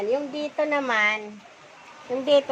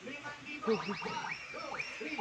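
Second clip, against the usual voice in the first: very quiet, lilting giggle; soft laughing; 3 semitones higher, 12 dB quieter than usual. Real, not acted. Vlogger showing viewers her stall, soft laughing. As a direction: giggle softly to yourself.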